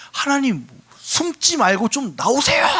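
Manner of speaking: high, loud mock plea; exasperated acted outcry; crying out to God. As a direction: call out as if demanding God show himself. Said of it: "이러는 거란 말이야".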